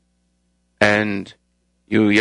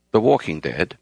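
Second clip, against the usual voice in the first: normal talking voice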